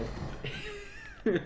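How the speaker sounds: very high-pitched giggle; high giggling laugh. Real, not acted. Amused by the arm. (risita muy aguda)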